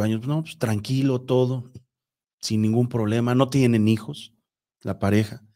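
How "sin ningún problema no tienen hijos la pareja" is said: usual speaking voice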